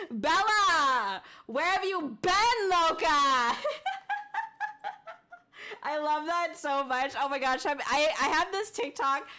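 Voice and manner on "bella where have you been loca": loud, drawn-out melodramatic cry; slow, swooping mock-dramatic call; hammed-up movie quote. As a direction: ham up the lovelorn line. Hammed-up quote of a film line.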